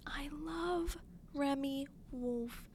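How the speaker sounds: breathy hushed whisper of adoration; adoring whisper. Real, not acted. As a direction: whisper it like a confession of love. Gushing about favorite singer.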